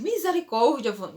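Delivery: husband hamming up his alarm in the car; exaggerated husband. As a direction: play the overdramatic husband in a panic. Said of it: "Meu marido é um pouquinho exagerado".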